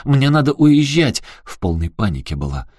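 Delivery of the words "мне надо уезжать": высокий громкий крик, медленнее в конце; закричи в отчаянии; панический крик. Acted. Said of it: "она как закричит". (Аляска в панике кричит на Эрика)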